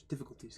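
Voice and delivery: "Difficulties" murmured to a horse-riding beat; galloping rhythm. Shown as a demonstration. Streamer imagines riding a horse, softly galloping the word. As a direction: let "Difficulties" trot along softly. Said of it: "Like you're riding a horse".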